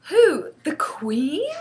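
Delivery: high, loud shocked question; aghast girl, voice leaping up. A narrator's reading. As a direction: cry out in horrified disbelief. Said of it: "Sophie cried aghast".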